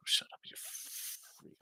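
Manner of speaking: whispered, slow put-down; hushed retort. Retold or imitated. Telling his Knicks-loving friend off, in a slow whisper. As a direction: hiss it under your breath, slowly. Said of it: "I'm like".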